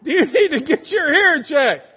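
loud, high mock scolding. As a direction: play a fed-up wife calling out. Exasperated wife follows up the punchline.

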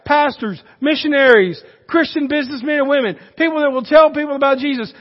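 loud, fired-up pulpit preaching; full-force preaching. Acted. Preacher rousing the young people in the congregation, full-force preaching. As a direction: preach it out to the whole room.

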